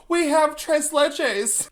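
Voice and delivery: high, loud, drawn-out mock yell; sustained high-pitched imitation of a hostess yelling; Dorothy yelling. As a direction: holler it out across the room, hammed up. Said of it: "Dorothy yells out".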